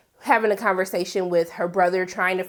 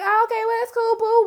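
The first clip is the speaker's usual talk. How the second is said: high, overly easygoing "that's cool, boo"; a hint of a syrupy boyfriend voice; lightly mocking sweet boyfriend; 13 semitones higher than usual. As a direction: play him as breezy and fake-chill. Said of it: "He was like".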